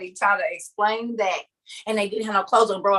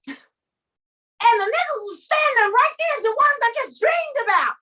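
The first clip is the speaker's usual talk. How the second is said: urgent insistence; 8 semitones higher than usual. Acted. Telling my kids or anybody about the dream, urgent insistence. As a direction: demand a hearing, pitch climbing. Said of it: "if y'all wanna know how I would say it".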